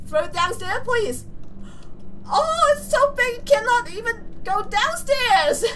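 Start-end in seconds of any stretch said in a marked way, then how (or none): none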